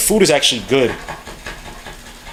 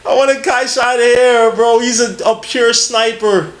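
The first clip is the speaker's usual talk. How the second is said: excited; 8 semitones higher, 4 dB louder, slower than usual. Real, not acted. Excited draft-night outburst.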